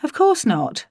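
high, too-quick innocent denial; unconvincing denial. A narrator's reading. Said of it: "I said unconvincingly and inaccurately".

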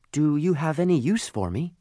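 high-pitched little coal voice, asking; small high voice offering itself; hopeful lump of coal. Acted. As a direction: ask hopefully, pitched well up. Coal asks Santa hopefully.

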